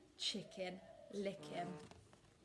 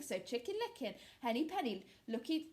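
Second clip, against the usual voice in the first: ordinary delivery, nothing marked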